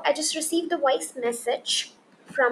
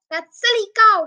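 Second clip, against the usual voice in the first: louder, higher jeering yell; angry yell; 8 semitones higher, 9 dB louder than usual. Acted. Horrid man in the crowd jeering Daisy, loud angry yell. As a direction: bellow the insult at the cow. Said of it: "yelled aloud".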